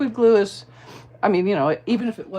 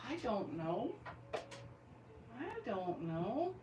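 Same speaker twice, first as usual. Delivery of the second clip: shrugging reply; 3 semitones higher, 15 dB quieter, much slower than usual. Pretend. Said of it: "And I'm gonna say".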